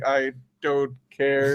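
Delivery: listless retold indifference; mock apathy. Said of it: "sitting there just like".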